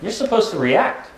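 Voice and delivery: indignant anxiety bully. Acted. Anxiety complaining when you won't react, high indignant protest. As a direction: protest like a bully being ignored. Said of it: "the anxiety goes".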